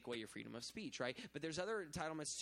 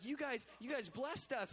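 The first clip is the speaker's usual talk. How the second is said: grateful locals; 9 semitones higher, 3 dB louder than usual. Retold or imitated. Locals thanking team.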